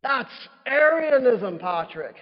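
loud, high, drawn-out Irish leprechaun outcry; bad Irish accent. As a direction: scold in a hammed-up Irish accent. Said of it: "my terrible Irish accent".

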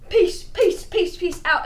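high, loud peace out; loud sign-off. Real, not acted. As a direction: shout the goodbye.

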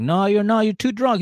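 high, loud imitation of a refusal; acted-out exasperated shouting; arguing back. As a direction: shut the drunk down in a mock quarrel. Retold argument over drinking.

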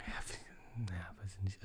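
unvoiced, doubtful whisper. Real, not acted. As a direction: breathe it under your breath. Doubting aside, under his breath.

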